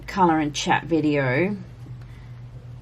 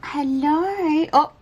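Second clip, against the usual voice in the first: higher, sing-song hello to the cat; 10 semitones higher, 4 dB louder, slower than usual. Real, not acted. Owner greeting her cat, high sing-song cat voice. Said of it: "That's my cat voice".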